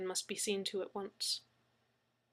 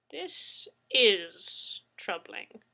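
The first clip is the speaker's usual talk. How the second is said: put-on slow, weighty old man musing; grave and slow; much slower than usual. A narrator's reading. Mad Zeke by his fire, slow and grave. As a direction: let each word sink. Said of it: "he said at last".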